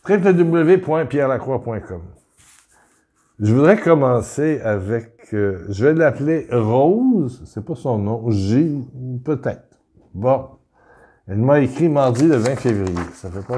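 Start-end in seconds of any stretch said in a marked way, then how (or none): none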